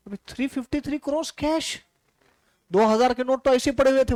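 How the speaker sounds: high-pitched, incredulous exclamation; amazed retelling. Retold or imitated. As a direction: build to a loud, high burst of amazement. Amazed retelling of huge cash haul.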